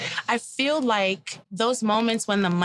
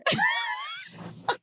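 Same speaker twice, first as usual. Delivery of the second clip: sudden high shriek of a laugh; high burst of laughter; 5 dB quieter than usual. Real, not acted. After quoting the date, a shrieking laugh.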